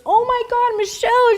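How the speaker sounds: very high, squeaky imitated weeping; hammed-up tearful squeal; crying. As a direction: mimic a woman tearfully crying out. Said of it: "she's crying".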